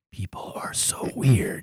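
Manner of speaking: hushed unvoiced aside; whispering. Real, not acted. Aside muttered to the table.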